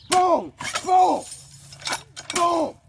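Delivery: high, loud booms; explosive high-pitched boom; shouted sound effect. Shown as a demonstration. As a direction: punch out each boom like an impact. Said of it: "you gotta do that".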